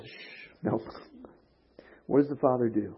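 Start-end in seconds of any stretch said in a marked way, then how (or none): 0.0-0.8 soft whispered shh, no; hushing whisper. Retold or imitated. Father hushing his returning son, whispered. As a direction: gently hush him, barely a breath. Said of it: "And the father just".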